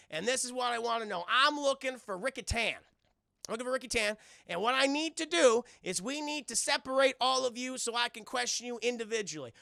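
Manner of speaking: Chris Tucker. Retold or imitated. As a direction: address the whole bar like a showman. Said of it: "And he's like".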